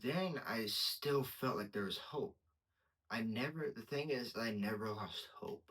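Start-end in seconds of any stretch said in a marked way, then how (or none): none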